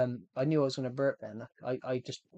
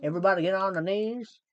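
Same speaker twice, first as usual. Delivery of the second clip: loud, higher yokel voice; hillbilly voice; 5 semitones higher, 8 dB louder than usual. Pretend. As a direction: shout it like a confused hillbilly. Acted hillbilly voice.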